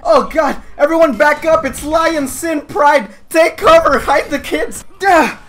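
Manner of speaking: high, loud hammed-up alarm; mock panic. Gamer warning villagers of clan kids, mock panic. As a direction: shout a pretend warning to the crowd.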